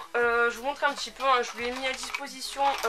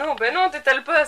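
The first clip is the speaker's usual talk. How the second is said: loud, high protest; 5 semitones higher, 15 dB louder than usual. Real, not acted. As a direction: cry out to stop him. Stops him spreading it.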